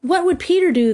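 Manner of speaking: high, eager exclamation; cried out. A narrator's reading. Boys turn to Peter in a dire moment, crying out. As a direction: call it out high and urgent. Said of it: "they cried simultaneously".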